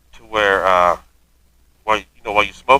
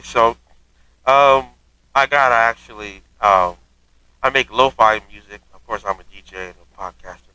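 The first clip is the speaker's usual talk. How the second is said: ordinary delivery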